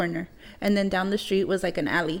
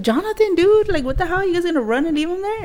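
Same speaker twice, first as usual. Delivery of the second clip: shouting much higher and louder at a friend; retold yell; 10 semitones higher, 4 dB louder than usual. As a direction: call out to him in outrage, high and loud. Yelling at friend to go back.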